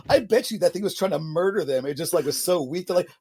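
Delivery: ordinary delivery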